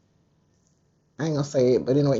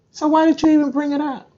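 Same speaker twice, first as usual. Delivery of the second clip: sharply higher, louder and fast, in disbelief; exasperated outburst; 10 semitones higher, 4 dB louder, faster than usual. Real, not acted. A vlogger ranting at Gayle King, outraged high burst. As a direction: fire the question at her, fed up.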